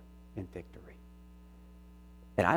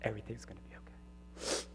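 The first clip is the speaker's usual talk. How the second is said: hushed repeat; 9 dB quieter than usual. Retold or imitated. Son's quote ends softly.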